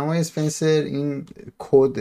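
usual speaking voice

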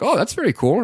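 retold wonder, voice swooping high; impressed. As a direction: react like someone pleasantly amazed. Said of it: "she was pretty impressed".

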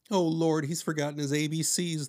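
high, loud dismayed moan.

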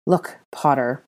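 through clenched teeth; spoken through clenched teeth. A narrator's reading. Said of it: "through clenched teeth".

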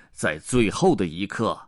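plain reading voice

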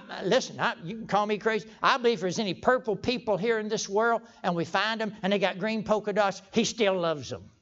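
usual speaking voice

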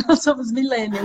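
ordinary talk, nothing marked

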